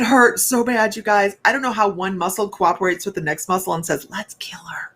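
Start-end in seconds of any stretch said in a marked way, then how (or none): 4.1-4.9 breathy whisper, the last words very soft. Real, not acted. Woman whispering the muscles' plot against her.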